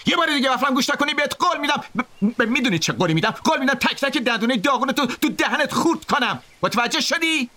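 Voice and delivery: high-pitched bully's threat; threatening shout. A narrator's reading. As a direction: threaten in a high, strained yell.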